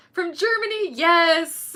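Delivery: high, loud delighted greeting; excited cheer. Real, not acted. Streamer greeting a viewer from Germany, excited cheer.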